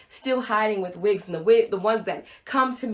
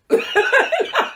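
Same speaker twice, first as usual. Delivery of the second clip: awkward laugh; 7 dB louder than usual. Real, not acted. Awkward moment with the teen.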